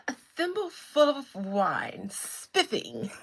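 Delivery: loud, playful British caricature; theatrical put-on British accent; mock British accent. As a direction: exclaim like a hammed-up Brit. Mock-British joke about her wine.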